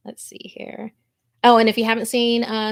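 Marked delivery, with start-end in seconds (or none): 0.1-0.9 breathy under-the-breath mutter to herself; whispered aside. Real, not acted. Streamer scanning chat, whispering to herself. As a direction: whisper it to yourself while looking something up. Muttering to self while scrolling.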